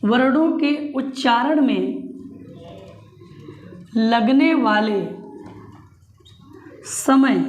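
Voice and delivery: slow, word by word, for note-taking; slow dictation. Shown as a demonstration. Teacher dictating a definition to the class, slow and deliberate. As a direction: spell it out slowly so students can write. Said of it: "note कर लो".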